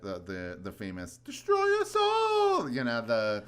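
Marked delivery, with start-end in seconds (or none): 1.2-2.7 high, drawn-out shout of a catchphrase; mock doom shout. Quoting the show's famous line, loud mock-dramatic shout. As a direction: belt it out high and long, quoting a show.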